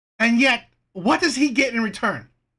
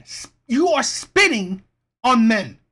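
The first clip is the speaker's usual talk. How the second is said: usual speaking voice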